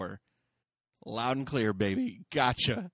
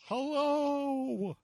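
high, drawn-out creepy call; eerie hello; 13 semitones higher than usual. Retold or imitated. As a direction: call out like something lurking outside. Retelling eerie movie moment.

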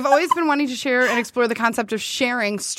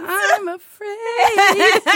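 drawn-out, higher mock-scared whimper; a hammed-up little quaver of fear; 8 semitones higher, 3 dB louder, much slower than usual. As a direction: pretend to be frightened, half laughing. Joking about scary impressions.

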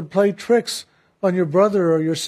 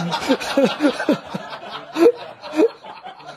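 long high peal of laughter; real laugh; 8 semitones higher than usual. Real, not acted. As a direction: let the laugh roll out. After the minister's punchline.

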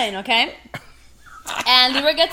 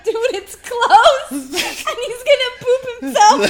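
laughing retelling; 6 semitones higher, 3 dB louder than usual. Retold or imitated. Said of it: "I'm like".

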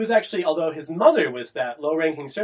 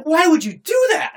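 high, louder, put-on outrage; mock indignant girl; 12 semitones higher, 7 dB louder than usual. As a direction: squeak out her disbelief. Girl voiced scolding her rescuer.

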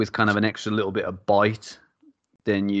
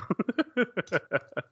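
short high-pitched giggle; 12 semitones higher, 4 dB quieter than usual. Real, not acted. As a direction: giggle with delight. Teasing about the brewer.

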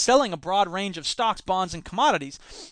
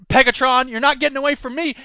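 hero taunt; 5 semitones higher, 6 dB louder than usual. Pretend.

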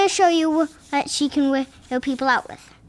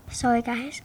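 whispering; 4 semitones lower, 6 dB quieter than usual. Real, not acted. Child whispers an apology.

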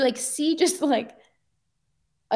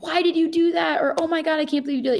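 higher-pitched mock complaining; acted reproach; 6 semitones higher, faster than usual. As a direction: imitate someone dwelling on a mistake. Voicing someone stuck on blame.

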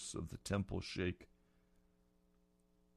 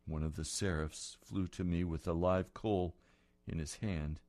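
ordinary talk, nothing marked

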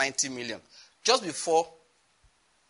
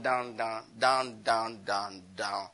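sinking voice; 5 semitones lower, 5 dB quieter, much slower than usual. Acted. Money sinking, voice sinks too.

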